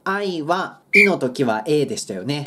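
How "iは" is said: ordinary talk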